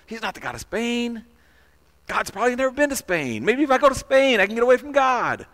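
high-pitched, musing voice of a runaway; Jonah. Acted. Preacher voicing the runaway prophet's private reasoning, high-pitched musing. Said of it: "maybe Jonah is thinking".

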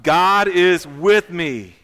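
slow, very loud declaration; loud rallying call for everyone to join. Shown as a demonstration. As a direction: proclaim it loudly, stretching each word. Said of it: "Say, say it louder".

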